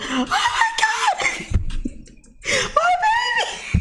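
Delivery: very high, stretched-out panicked wail; mock screaming mom. Mom running into the room to her baby, mock screaming. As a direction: imitate a mother shrieking in horror, laughing as you go. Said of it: "I remember her running in the room".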